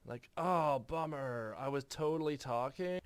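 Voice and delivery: skater surfer voice. Acted. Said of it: "it's too skatery anyway".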